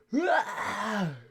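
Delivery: quick amused laugh; a burst of real laughter; short laugh. Real, not acted. Laughing at own remark.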